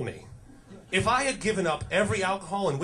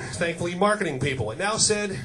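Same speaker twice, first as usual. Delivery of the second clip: normal talking voice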